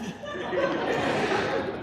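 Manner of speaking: quiet airy chuckle; soft breathless laugh. Real, not acted. Retelling the Philips call, soft breathy real laugh. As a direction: laugh under your breath.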